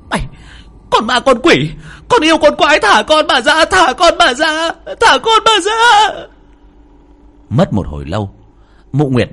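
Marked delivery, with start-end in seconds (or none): 0.1-6.1 high, loud screaming curses; enraged. Acted. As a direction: scream at the demon to release your child. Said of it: "như phẫn nộ".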